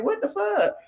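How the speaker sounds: high-pitched incredulous question; mimicked disbelief. Retold look of disbelief at him.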